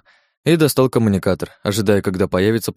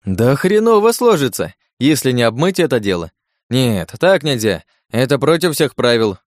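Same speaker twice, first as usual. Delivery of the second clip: brightened up; 4 semitones higher, 3 dB louder than usual. Acted. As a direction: suddenly cheer up and protest. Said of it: "вдруг просиял".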